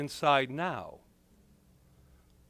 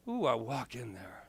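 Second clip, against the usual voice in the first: hushed retelling; 4 semitones lower, 8 dB quieter than usual. Retold or imitated. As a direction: drop low and soft on a sly ooh. Same words, now hushed.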